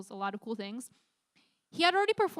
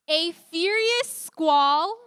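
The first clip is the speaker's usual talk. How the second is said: slow, loud, higher build on furious squall; dramatic storm telling; 6 semitones higher, 10 dB louder, much slower than usual. A narrator's reading. Youth pastor dramatizing the storm for teens, loud and slow. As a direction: paint the storm big and slow.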